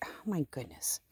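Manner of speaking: breathy whispered exclamation. Real, not acted. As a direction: whisper it in awe.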